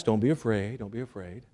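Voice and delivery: hushed, soft reassurance; soothing. Retold or imitated. Calming a startled Zacharias, hushed and soothing. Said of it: "he had to be calmed down".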